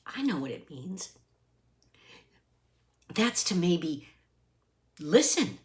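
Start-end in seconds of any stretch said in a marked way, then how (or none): 0.1-1.1 unvoiced secret whisper. Real, not acted. The reader answers her own question in a whisper. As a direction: whisper it to yourself.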